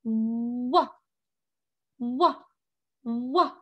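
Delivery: wah as a clear w sound from puckered lips; letter sound demo. Shown as a demonstration. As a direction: say wah with rounded lips opening wide. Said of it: "you open them wide like this".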